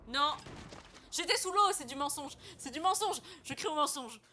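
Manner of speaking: loud, high mock indignation; outraged protest. Real, not acted. Streamer yelling it's a lie at teammates. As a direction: cry foul at full volume. Accused of being seen, protests.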